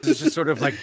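usual speaking voice

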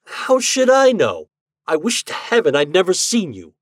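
high, loud exasperated outburst; crying out. Acted. Husband at his wits' end with the ghost, crying out. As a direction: cry out at the unwelcome visitor. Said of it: "I cried".

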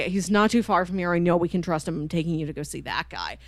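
usual speaking voice

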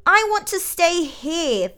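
put-on high, loud, drawn-out child voice; slow, high protest of a small boy; young dissenter. A narrator's reading. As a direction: dig in like a stubborn toddler. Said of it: "the young dissenter".

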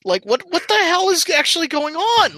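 very high-pitched, exasperated bewilderment; incredulous outburst. Acted. Acting a viewer lost in The Two Towers, incredulous. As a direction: pitch shoots up in mock outrage. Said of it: "And you're just like".

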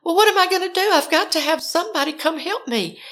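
high-pitched exasperated begging; desperate plea. Retold or imitated. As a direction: plead as if out of options. Desperate caller after refusal.

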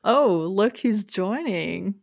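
ordinary talk

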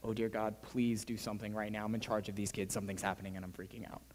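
low, hushed inner plea; murmured private freak-out; silent panicked prayer. Retold or imitated. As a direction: pray under your breath, fading away. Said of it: "And so inside, I'm like".

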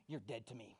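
low, soft deadpan warning; quiet threat. Acted. Peter warning about the four hundred ninety-first time, quietly menacing.

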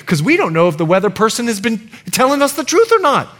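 normal talking voice

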